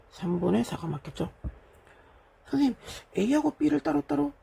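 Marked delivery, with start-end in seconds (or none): 2.5-4.3 higher-pitched, slower student question; student asking. Acted. Teacher mimicking a student's query to the teacher, high questioning voice. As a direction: put on a pupil's high, questioning voice.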